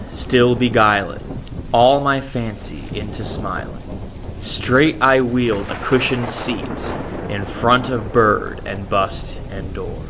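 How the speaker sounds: ordinary delivery, nothing marked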